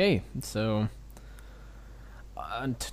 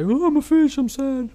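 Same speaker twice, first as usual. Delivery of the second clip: very high, loud sad cartoon fish; 9 dB louder than usual. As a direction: squeak it out as a mopey fish. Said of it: "I'll be the fish".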